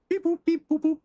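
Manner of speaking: high imitated scanner beeps and boops; high electronic-style vocal bleeps; mock sound effects. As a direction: beep like a little computer. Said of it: "There will be sound effects".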